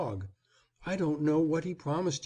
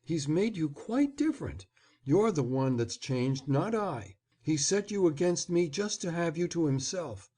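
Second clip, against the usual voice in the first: flattering, excited; 7 semitones higher than usual. A narrator's reading. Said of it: "a voice flattering with the excitement quivering in it".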